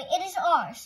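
indignant and loud; insistent quarrel. A narrator's reading. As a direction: insist on it like a squabbling kid. Playground quarrel over the ball.

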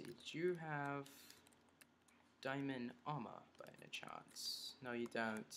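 put-on soft, slow British accent. Player asking an opponent for diamond armor, mock British accent. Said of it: "I'm not doing a British accent".

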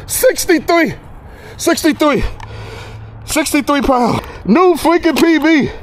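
high, excited proclaiming of a record; amazed, elated announcement. Real, not acted. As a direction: call out the record in disbelief. Reads record weight off scale.

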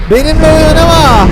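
warning shout. Pretend. Dinosaur warns fleeing friend, loud threatening shout. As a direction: raise the voice to a threatening warning on "oynama". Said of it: "Bağırırım böyle".

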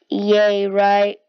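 loud, hollow mock celebration; loud deadpan yay; sarcastic cheer. Real, not acted. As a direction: cheer sarcastically for a dull prize. Sarcastic cheer about the armor.

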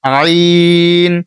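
আইন from a pressed throat, drawn long; throat-pressed Arabic letter. Shown as a demonstration. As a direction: squeeze the throat and stretch আইন. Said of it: "এটাও চার second বা তিন second টানবেন". (গলা চেপে আইন টেনে)

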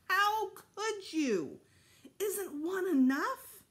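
put-on boy yelling at parents over new baby; shouting. A narrator's reading. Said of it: "I kept right on shouting".

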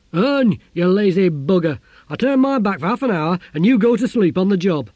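loud, high, furious yell at a lazy nephew; angry shouting. Acted. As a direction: bellow it out in a rage. Uncle scolds idle nephew.

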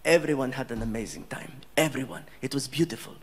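low, measured, dignified thanks; gracious prince. Retold or imitated. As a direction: speak like a composed, gracious royal. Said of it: "He's like".

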